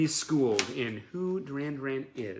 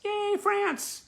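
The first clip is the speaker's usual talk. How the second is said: high, squealed cheer for France; excited cheer; 4 dB louder than usual. Acted. A fan cheering at a French postcard, squealed high.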